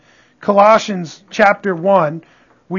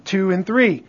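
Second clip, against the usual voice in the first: normal talking voice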